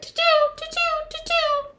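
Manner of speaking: tiny, very high tooting chirps; purple martin call. A narrator's reading. Purple Martin in the noisy recap, high tooting. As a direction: toot quickly in a high bird voice. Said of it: "The purple martin said".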